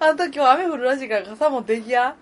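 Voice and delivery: mock mom. Playing nagging mother.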